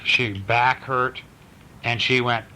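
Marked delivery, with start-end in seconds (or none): none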